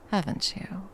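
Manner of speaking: normal talking voice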